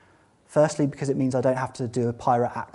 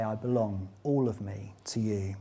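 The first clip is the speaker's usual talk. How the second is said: soft prayer; 4 semitones lower, 7 dB quieter than usual. Shown as a demonstration. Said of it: "to just say".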